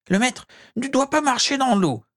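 very high, squeaky warning; high-pitched anxious-to-warn house-elf. A narrator's reading. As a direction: caution the master in a tiny creature voice.

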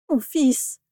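high anguished cry; moaning.

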